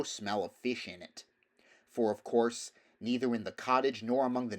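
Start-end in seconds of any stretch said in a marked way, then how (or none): none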